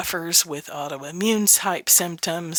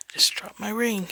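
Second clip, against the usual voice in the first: a quick whispered aside; 2 semitones higher, 4 dB quieter than usual. Real, not acted. To the camera after dropping a ring, a soft whispered aside. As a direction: drop to a whisper for the slip.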